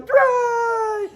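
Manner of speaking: Drei! squealed very high; excited child shout. Retold or imitated. Mother imitating daughter on walk, squealed Drei!. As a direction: shriek Drei! like a toddler running off. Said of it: "she starts to run".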